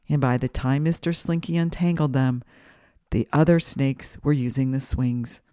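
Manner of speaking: usual speaking voice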